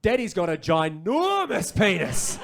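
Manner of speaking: put-on slow, loud toddler proclamation; two-year-old boy. Toddler son quoted verbatim, loud drawn-out announcement. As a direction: proclaim it like an amazed small child. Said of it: "a two and a half year old, he said".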